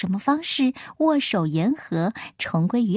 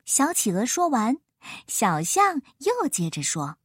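plain reading voice

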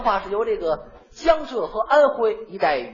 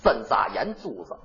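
"针扎眼珠子" low and slurred in Tianjin dialect; Tianjin accent; 10 semitones lower, 3 dB quieter than usual. Shown as a demonstration. Imitating Tianjin speech for his partner, low and slurred. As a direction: mumble "针扎眼珠子" the Tianjin way, lower. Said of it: "您给学学".

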